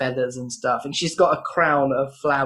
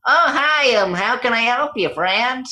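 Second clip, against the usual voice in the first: high, loud cheery little woodworker; annoying craftsman voice; 9 semitones higher, 6 dB louder than usual. Acted. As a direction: chirp it out like an overly friendly little craftsman. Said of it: "Oh, that's not an annoying voice".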